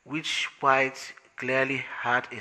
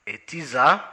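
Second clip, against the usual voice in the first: "A teaser?" repeated louder, rising at the end; puzzled question; 2 semitones higher, 9 dB louder than usual. Acted. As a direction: echo the word back, baffled.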